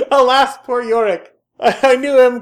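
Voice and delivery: laughing mock Hamlet. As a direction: ham up the famous line while cracking up.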